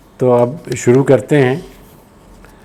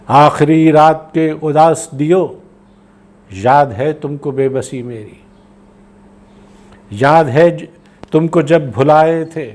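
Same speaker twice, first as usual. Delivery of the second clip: poetry recitation; 3 semitones higher, slower than usual. Acted. Poet saluting streets and friends of home, slow lifted recitation. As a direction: recite in long phrases with clear pauses.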